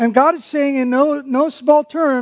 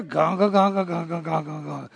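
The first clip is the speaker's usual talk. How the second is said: low ganga sinking much softer to nothing; grumbling noise; 6 semitones lower, 8 dB quieter than usual. Shown as a demonstration. Preacher acts out grumbling, dying mutter. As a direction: grumble down into silence. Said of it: "a word that sounds like what it is".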